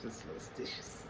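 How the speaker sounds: whisper. Pretend. Office joke quote, whispered aside.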